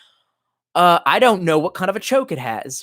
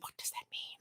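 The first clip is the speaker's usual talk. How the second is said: whispered mom; 27 dB quieter than usual. Retold or imitated. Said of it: "she's like".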